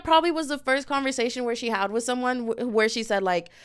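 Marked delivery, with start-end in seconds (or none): none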